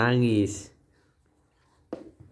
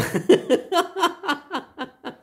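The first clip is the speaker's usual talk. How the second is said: high-pitched helpless laugh; laughter breaking out; laughing fit. Real, not acted. Mother laughing then crying. (tawa lepas)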